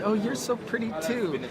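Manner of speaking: high-pitched mock girly compliment back; imitated rival's sugary comeback; fake-nice reply. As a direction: return the compliment with insincere sweetness, pitched well up. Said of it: "just a nice way to say".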